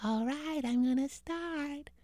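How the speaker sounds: spooky ghost voice. Acted. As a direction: moan it like a little ghost. Ghost tells a ghost story.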